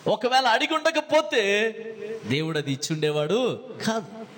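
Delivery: ordinary delivery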